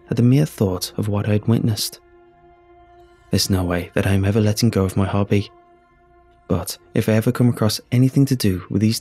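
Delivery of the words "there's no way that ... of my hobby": ordinary delivery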